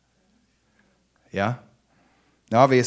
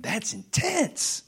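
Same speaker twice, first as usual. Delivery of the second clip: awed reaction; 12 semitones higher, 4 dB quieter, much slower than usual. Real, not acted. Reacting to the passage.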